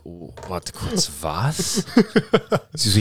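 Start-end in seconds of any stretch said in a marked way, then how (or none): 0.0-1.8 low, slow, quiet disbelief; retold dazed surprise. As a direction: retell a slow, stoned double take. Retold stoned reaction to sister.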